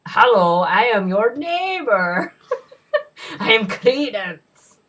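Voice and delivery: hammed-up spooky introduction; creepy neighbour voice. Acted movie character voice.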